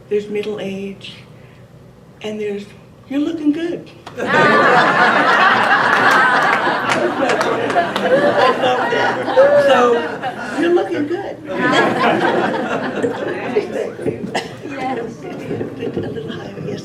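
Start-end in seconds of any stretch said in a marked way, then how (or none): 3.1-3.9 mock-cheery "you're looking good" line, a bit higher; polite compliment. To the gathering, a hammed-up polite compliment. Joke punchline, third stage of life. 4.1-15.5 higher, laughing through the words. Real, not acted. Repeating her own joke to the crowd, laughing.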